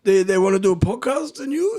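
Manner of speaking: high-pitched mock French accent; Nico. Guest voicing workmate amazed at podcast invitation, French accent. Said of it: "what's the French accent?".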